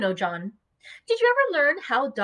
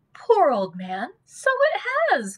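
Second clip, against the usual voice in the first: sympathetic cry; 9 semitones higher, 3 dB louder than usual. Acted. Dot pitying her cold husband, rising into a cry. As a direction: feel for him, then burst out. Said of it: "cried Missus Peerybingle".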